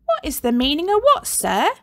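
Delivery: high, quick mock-innocent question; innocent reply. A narrator's reading. Girl sidles up to the angry teacher, feigning innocence. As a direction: play dumb sweetly. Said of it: "Rebel innocently".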